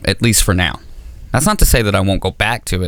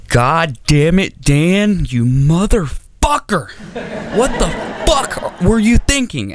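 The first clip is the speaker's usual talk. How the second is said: higher, slow mock-furious snarl; imitating angry dad; 9 semitones higher, slower than usual. Dad yelling at his son Dan, slow mock fury. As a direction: curse him out like a raging father. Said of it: "he would just be like".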